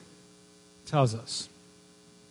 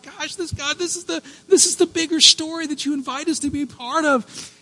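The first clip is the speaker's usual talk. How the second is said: tearful awe; 11 semitones higher than usual. Retold or imitated. Retelling his hallway prayer, high and tearful. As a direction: voice it near tears, overwhelmed. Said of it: "And I'm like".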